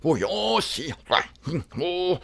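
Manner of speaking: soft, garbled mock-German rant; badly faked German dictator in nonsense sounds; hammed-up German gibberish imitation; Hitler. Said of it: "My Hitler would be like".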